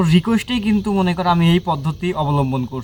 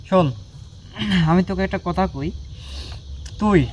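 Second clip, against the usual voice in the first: normal talking voice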